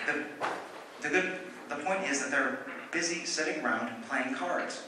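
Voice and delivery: usual speaking voice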